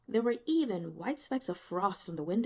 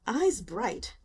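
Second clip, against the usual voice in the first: ordinary delivery, nothing marked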